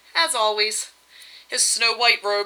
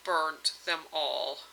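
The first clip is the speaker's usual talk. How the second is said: low, soft, slow gloomy mutter; 4 semitones lower, 8 dB quieter, much slower than usual. Acted. Husband confessing to his wife, gloomy slow mutter. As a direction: grudgingly mumble the loss, slow and low. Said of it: "muttered her husband gloomily".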